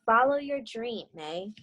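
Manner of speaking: gentle read-aloud storytelling voice; story narration. A narrator's reading. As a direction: read it like a picture book to young students. Teacher reads picture book aloud.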